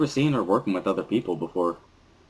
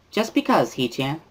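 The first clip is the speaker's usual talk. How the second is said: put-on bubbly cheerful girl; 9 semitones higher than usual. Misha cheering that the group is together again, high bubbly girl voice. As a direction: squeal it out like an overexcited classmate.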